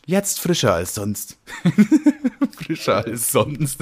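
slow, drawn-out commercial slogan; advertising voice. Shown as a demonstration. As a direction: savour the slogan like an ad. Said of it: "machen wir jetzt Werbung für". (langsame Werbestimme)

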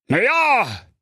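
high, slow fussy king voice; a puzzled old king raising a complaint. A narrator's reading. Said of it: "fuhr der König fort".

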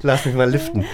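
talking through laughter; laughing self-mockery. Real, not acted. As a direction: joke while laughing. Joke about needing a facelift.

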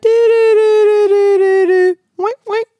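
high sung dü dü beeps; high beeping sound. Retold or imitated. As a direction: chant the beeps high and loud. Imitating game beeps, high.

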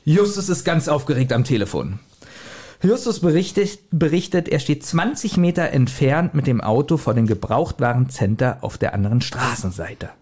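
ordinary delivery

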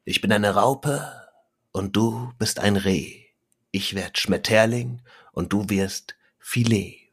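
slow, deliberate poem reading with pauses between lines; poem recital. Shown as a demonstration. Podcast guest recites his short rhyming poem to listeners, slow and measured. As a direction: recite it slowly, letting the punchline land. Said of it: "und geht so".

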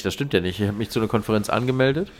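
normal talking voice

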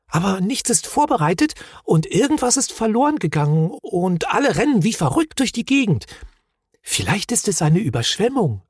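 high-pitched anxious son's voice; fretful little storybook creature; young, worried Moomin. A narrator's reading. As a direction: pass on alarming news in a high voice.

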